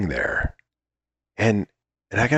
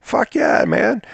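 much higher, jubilant call of cut; thrilled director; 13 semitones higher, slower than usual. Retold or imitated. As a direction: call cut like the take was perfect.

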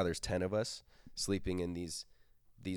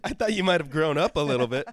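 higher, louder teasing echo of the line; mocking repeat; 9 semitones higher, 10 dB louder than usual. Friend repeats the ex's jab to tease, higher and louder. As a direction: mimic her line back to tease. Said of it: "That was a bad joke".

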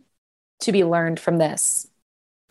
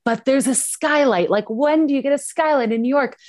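excited pleading; 6 semitones higher than usual. Retold or imitated. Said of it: "And I was like".

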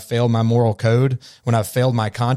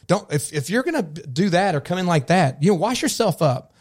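higher, sharp scolding parent voice; raised stern parent telling off a child; 6 semitones higher than usual. Retold or imitated. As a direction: shame the kid out of the room. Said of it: "you show up snotty and say".

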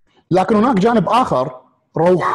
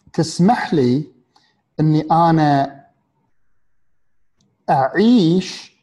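slow; much slower than usual. Real, not acted. Stretched, slow key phrase.

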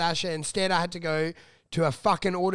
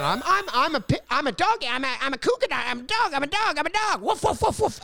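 rising higher into silly woofs; mock dog act; 8 semitones higher than usual. Imitating the comic's dog bit on stage, higher and higher. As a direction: bark it out like a manic dog act.

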